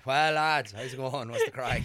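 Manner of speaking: loud, full-blown Monaghan greeting; hammed-up rural Monaghan accent; Monaghan country accent. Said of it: "like, fully blown, like, Monaghan country".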